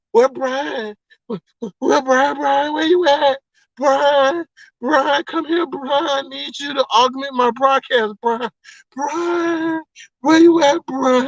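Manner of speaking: high, drawn-out pleading wail; mock begging. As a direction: imitate someone desperately calling out for a missing friend. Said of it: "Duchess was begging you to".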